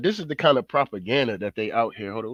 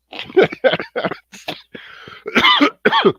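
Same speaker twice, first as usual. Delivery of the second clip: squealing laugh, pitched way up; high cackling laugh; 6 dB louder than usual. Real, not acted. Vlogger cracking up at his own mock quote, high squealing laugh.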